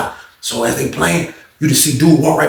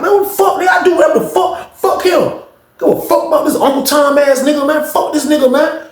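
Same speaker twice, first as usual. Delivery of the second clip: furious shout; 12 semitones higher than usual. Retold or imitated. Benched player cursing out his coach, furious shout. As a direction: blow up at him, all rage.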